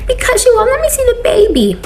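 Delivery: high, whiny imitation of the crying mother-in-law. Imitating the crying mother-in-law to her son, high and whiny. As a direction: mimic her tearful complaint in a high voice.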